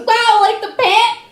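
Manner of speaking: high, delighted clerk question; chirpy clueless clerk. Retold or imitated. Comic voicing the Old Navy lady asking about the pant, high and chirpy. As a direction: impersonate the clueless cashier. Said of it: "the Old Navy lady goes".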